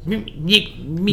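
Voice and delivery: mock grumpy little kid; grumpy child. Tired five-year-old on a desert outing, imitated grumpy. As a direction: imitate a child grumbling, lightly. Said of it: "she is just grumpy".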